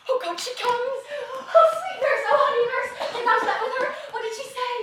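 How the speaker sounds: high, loud, laughing with delight; joyful excitement. Acted. Juliet greeting the returning nurse, loud laughing joy. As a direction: burst out happy, laughing.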